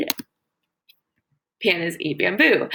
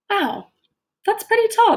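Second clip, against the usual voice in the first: high, louder wow for the class; amazed; 12 semitones higher, 4 dB louder than usual. Acted. Teacher marvelling at panda size for students, high and excited. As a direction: show the kids it's amazing.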